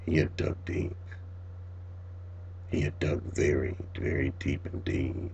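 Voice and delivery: low, soft, half-whispered dread; hushed dread. A narrator's reading. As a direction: let the horror sink in softly. Dread over the dug earth.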